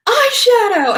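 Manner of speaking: high bright shout of surprise; excited exclaim. Retold or imitated. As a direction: blurt it out like a stranger spotting it. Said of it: "people are like".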